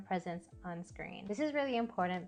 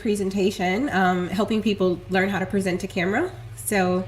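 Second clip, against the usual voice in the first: video call audio; 15 dB louder than usual. Real, not acted. Recorded call, distorted.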